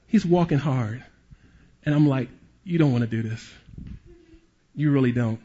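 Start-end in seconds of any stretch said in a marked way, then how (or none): 2.6-5.4 low warning. Retold or imitated. Warning the angry man.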